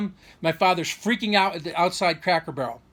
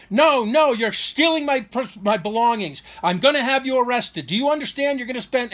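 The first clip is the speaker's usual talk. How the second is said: high, raised mock tirade; retold scream; 6 semitones higher, 5 dB louder than usual. Father accusing son of stealing his tapes, retold scream. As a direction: imitate an outraged accuser, pitch jumped up. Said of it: "He's screaming".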